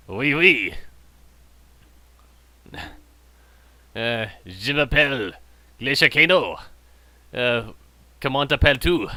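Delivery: put-on halting schoolbook French accent; mock French. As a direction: imitate a French speaker, piece by piece. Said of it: "about all I remember French".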